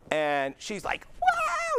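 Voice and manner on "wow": high, loud squeal of delight; patient shrieking with joy after her first walk; excited scream. Retold or imitated. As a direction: shriek it in amazement. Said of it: "And she's screaming".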